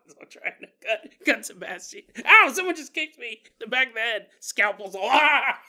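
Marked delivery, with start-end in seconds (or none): 2.2-4.3 loud, high mock yelp of surprise; pained outcry. Acting doctor kicked in head. 5.1-5.6 loud, high mock scream; short hammed-up cry of alarm; startled scream. Acting doctor slipping with scalpel.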